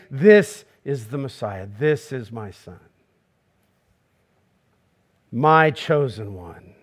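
low, slow and solemn; voice of God. Acted. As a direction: speak the words as God, hushed and grave. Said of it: "to hear God say".

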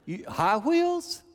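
high, slow, drawn out; stunned disbelief. Retold or imitated. Grandpa stunned by Jackson's wish, slow high disbelief. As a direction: stretch it out in disbelief. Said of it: "I went".

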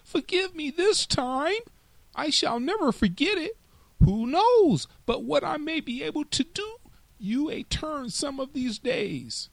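a tiny mouse begging the king gently; soft squeaky mouse begging for its life; pleading little mouse. Acted. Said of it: "cried the little mouse".

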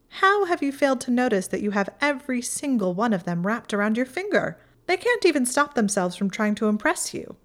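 a hint of haughty Pansy, teasing. A narrator's reading.

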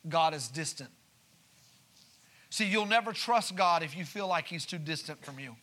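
normal talking voice

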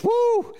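high loud woo; whoop. Retold or imitated. Shambach alone after service, loud nervous woo. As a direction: let out a loud woo of nerves.

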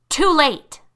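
loud, high triumphant yell. Acted. Tanya yelling at Lisa as the car speeds on. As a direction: shout it with cruel glee. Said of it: "Tanya yelled".

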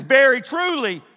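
loud, high proclamation; ringing, exasperated declaration; Jesus telling off Pharisees. Acted. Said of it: "this is Jesus right here telling".

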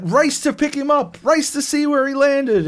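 high, loud, over-eager game announcer; hammed-up mission command; mock urgent prompt.

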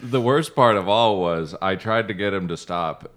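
ordinary delivery

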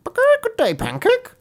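very high, squawky hen greeting; loud, high-pitched hen voice; clucking hen. A narrator's reading. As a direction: cluck into the words like a chicken. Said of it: "said the hen".

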